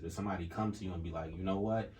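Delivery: ordinary delivery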